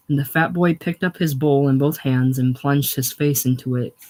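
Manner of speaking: plain reading voice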